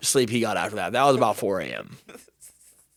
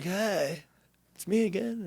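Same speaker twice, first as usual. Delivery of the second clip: sheepish greeting; 7 semitones higher, 9 dB quieter, slower than usual. Retold or imitated. Sheepish greeting after bad night.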